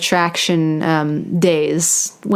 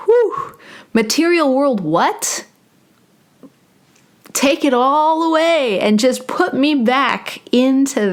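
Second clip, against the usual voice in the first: high-pitched, hammed-up swoon; playful euphoric exclaiming; blissed-out awe; 6 semitones higher than usual. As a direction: act swept away by bliss, voice lifting. Acts out awe after tasting bliss.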